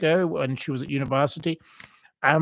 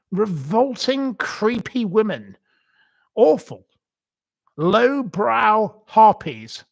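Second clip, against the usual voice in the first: slow disgust; 5 semitones higher, 4 dB louder, slower than usual. Real, not acted. Ranting about TV presenters.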